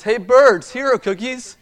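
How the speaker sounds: high loud call to the birds; loud shout. Acted. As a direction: shout it out across the yard. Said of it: "He shouted in a loud voice".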